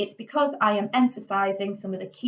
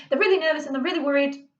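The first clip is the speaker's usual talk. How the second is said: rushed, slightly raised nervous gabble; fast nervous demo; 6 semitones higher, much faster than usual. Shown as a demonstration. Teacher showing students a nervous character's fast pace. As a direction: hurry it like a worried character. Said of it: "they might be talking really, really fast".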